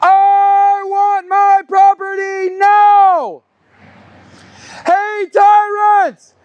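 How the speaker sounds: slow, drawn-out angry shout; yelling. Real, not acted. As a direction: bellow the demand at the building. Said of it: "I'm gonna go back to yelling".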